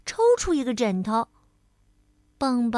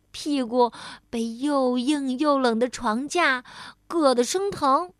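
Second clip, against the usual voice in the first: plain reading voice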